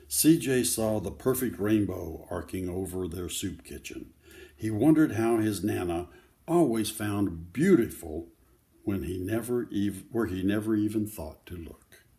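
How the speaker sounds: lively picture-book read-aloud; reading to children. Acted. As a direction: tell the story to a circle of kids.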